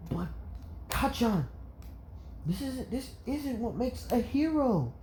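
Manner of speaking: put-on very high, earnest child voice; pleading little boy. Izuku pleads with Bakugo, earnest child. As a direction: plead with him in a piping voice.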